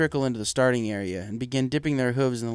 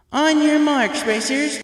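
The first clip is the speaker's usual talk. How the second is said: much higher, louder starting call; loud race call; 7 dB louder, slower than usual. Acted. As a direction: call the racers to the line. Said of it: "Twilight announced from up top".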